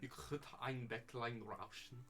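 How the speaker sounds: put-on German accent. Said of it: "can give you an accent".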